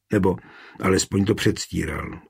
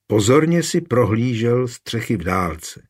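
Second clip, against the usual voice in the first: ordinary delivery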